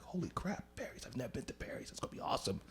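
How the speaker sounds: hushed, thrilled whisper; giddy whispered excitement; excited whisper. Retold or imitated. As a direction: whisper it like a secret treat. Excited whisper about Perry's.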